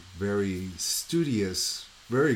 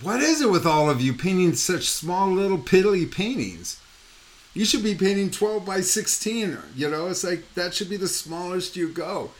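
higher, louder exasperated scolding; worked-up lecturing at a raised pitch; mock exasperation; 8 semitones higher, 4 dB louder than usual. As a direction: berate the painters, hammed up. Retelling own scolding of painters.

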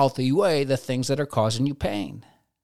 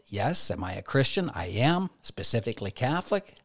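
normal talking voice

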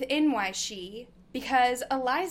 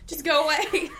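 laughing; 5 semitones higher, 6 dB louder than usual. Real, not acted.

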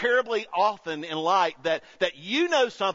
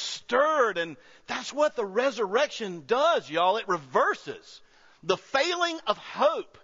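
full-force preaching; 6 semitones higher than usual. Acted. Pastor preaching resurrection hope to the congregation, building higher. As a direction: preach it, rising and stretching each phrase.

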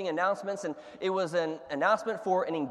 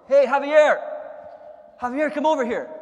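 much higher and very loud, calling across the store; 8 semitones higher, 10 dB louder than usual. Retold or imitated. Grocer calling across the store to Javier, loud call. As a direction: shout for a coworker far away.

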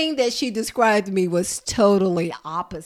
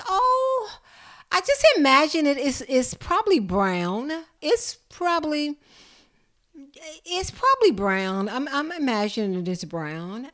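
put-on quoted elderly client; 7 semitones higher, 3 dB louder than usual. Client guessing speaker's hair.

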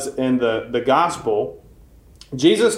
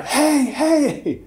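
high, calling hey across the field; retold shouting; 13 semitones higher than usual. Priest calling across the yard, high mock shout. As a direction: yell to stop him from afar. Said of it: "a shouting".

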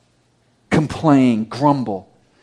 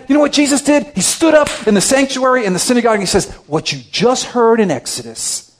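high, loud, emphatic preaching; full-force preaching; 4 semitones higher, 4 dB louder than usual. Acted. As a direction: proclaim it like a fired-up preacher.

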